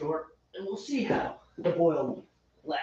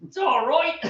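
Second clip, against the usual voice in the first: echoing in a higher, light put-on English accent; mock British accent; 9 semitones higher than usual. Echoing the friend's line in mock British accent. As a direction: parrot the English accent back playfully. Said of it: "they do American accents the same way we do that".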